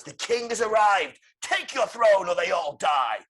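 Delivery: high, loud jester's decree; theatrical Joker threat. Acted. Joker threatens hostages before Batman, theatrical shouted decree. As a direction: proclaim it, building to a shouted ultimatum.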